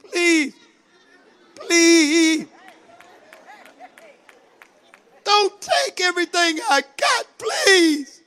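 high, wailing plea with drawn-out pleases; desperate begging. Acted. Servant begs his lord to spare his family, desperate. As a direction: plead desperately, voice climbing on each please. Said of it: "You begged me".

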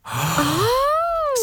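a high, drawn-out "oh" of surprise; surprised oh. Real, not acted. Cohost hearing about the sheriff's note, surprised. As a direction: gasp out the word in shock. Reacting to phone number story.

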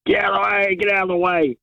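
calling out. Retold or imitated. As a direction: act out calling over people's heads, without really shouting. Retelling people calling out.

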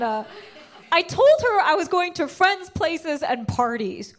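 ordinary talk, nothing marked

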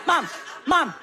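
lightly voiced nagging kid; pestering son. Son in the back seat pestering mom, insistent. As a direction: repeat it insistently like a child after attention. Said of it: "then my son".

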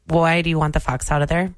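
ordinary delivery

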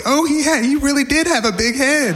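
high, giddy exclamation; hysterical delight. Retold or imitated. Son recalling dad's joke so funny, delighted. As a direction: burst out high, tickled by the joke.

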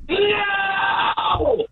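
very high, long held yell heard from across the room; walk away from the phone and bellow it, held long; record-style shout from afar. Shown as a demonstration. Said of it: "Now, now you're testing".

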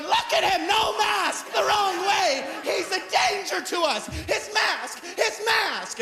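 high-pitched alarmed shouting lady; shrieking busybody. Retold or imitated. Comic voicing the lady alerting other shoppers in a high shriek.

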